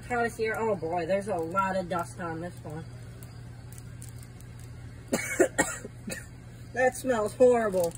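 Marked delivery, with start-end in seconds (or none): 2.9-6.2 harsh dry coughing; coughing fit. Real, not acted. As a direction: hack and cough hard. Coughing on the dusty chip.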